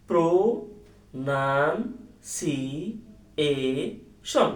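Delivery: "Pronunciation" very slowly as pro, nan, si, e, shon; syllable by syllable. Shown as a demonstration. Teacher showing that syllables fix the spelling, very slow syllable by syllable. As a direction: stretch each syllable of "pronunciation" with clear gaps between. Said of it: "এটা just syllable করলেই".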